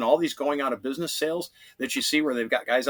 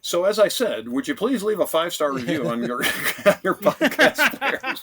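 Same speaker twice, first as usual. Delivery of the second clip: laughing; 7 semitones higher, 7 dB louder than usual. Real, not acted. Joking plea for reviews.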